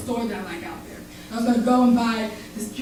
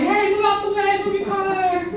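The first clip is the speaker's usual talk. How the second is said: higher, impatient mock drivers yelling; imitating angry drivers; annoyed drivers; 8 semitones higher than usual. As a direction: bark it like an irritated passing motorist. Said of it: "everybody's like".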